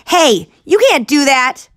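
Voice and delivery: high loud protesting yell. Acted. Boy yelling at Tank, loud protest. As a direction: yell in outrage. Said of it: "Richie squatted beside his friends and yelled".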